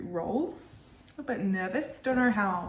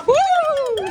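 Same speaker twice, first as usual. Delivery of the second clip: high, loud whoop of joy; excited cheer; 12 dB louder than usual. Real, not acted. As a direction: let out a big happy whoop. Cheering mid-marathon.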